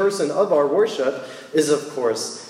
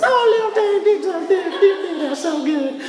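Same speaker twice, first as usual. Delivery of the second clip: put-on very high sing-song baby talk to a dog, louder; 4 dB louder than usual. Shown as a demonstration. Talking to his dog Gigi in a very high sing-song dog voice. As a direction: coo up high like praising a puppy. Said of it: "That's my dog voice".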